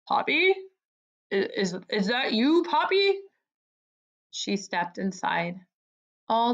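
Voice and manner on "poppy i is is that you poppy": stammered question rising high at the end; falter, then lift the name in amazement; startled disbelief. A narrator's reading. Longworth breaking off the speech on spotting Poppy, startled.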